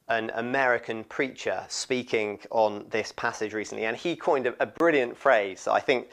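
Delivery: normal talking voice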